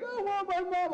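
put-on high, loud kid's wail; mock screaming. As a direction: imitate a little kid wailing for mom. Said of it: "start screaming".

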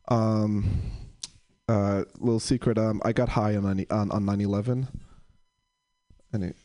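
ordinary delivery

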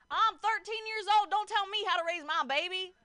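put-on loud, high country-accent outburst. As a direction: holler it in a hammed-up country accent. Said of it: "like, in a country accent".

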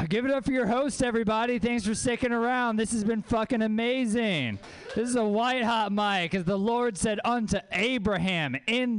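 high, pumped-up stage hype; mock-preacher hype, pitched high; hype-man opener. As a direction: whip up the crowd in a high voice. Opens set in mock hype voice.

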